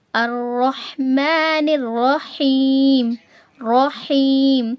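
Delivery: very slow chant with a clear mid-throat ح in الحمد and الرحمن; ہا in recitation. Shown as a demonstration. Teacher modelling ح in Quran verses, slowly chanted. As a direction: hold each long vowel, articulate ح cleanly from the throat. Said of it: "اس طرح سے آپ جو ہے ہا کی ادائیگی".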